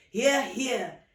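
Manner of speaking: group agreeing. Acted. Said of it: "everybody agreed".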